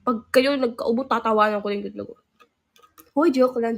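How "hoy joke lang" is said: loud playful protest; quick take-back. Real, not acted. Streamer loudly takes back her joke to viewers. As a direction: loudly insist it was just a joke.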